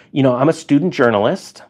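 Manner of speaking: usual speaking voice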